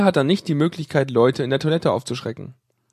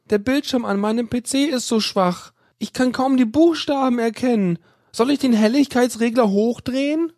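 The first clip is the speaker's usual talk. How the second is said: put-on higher timid young woman's voice; worried female caller; 8 semitones higher than usual. A narrator's reading. Female student asking the admin on the phone, timid. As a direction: play the unsure caller asking.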